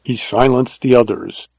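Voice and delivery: plain reading voice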